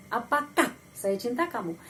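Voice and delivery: ordinary talk, nothing marked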